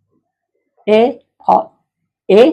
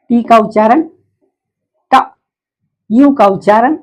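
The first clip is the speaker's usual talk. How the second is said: ordinary talk, nothing marked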